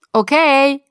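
loud, high sung-out reply; bright-pitched answering call; calling back. Acted. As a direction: holler back over your shoulder. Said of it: "rief ich zurück".

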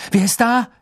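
calling out. A narrator's reading. Harry calls into dark woods.

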